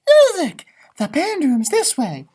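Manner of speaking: high eager burst; excited call. Acted. Gingerbread man calling the class, excited. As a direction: call out the discovery. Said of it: "He says".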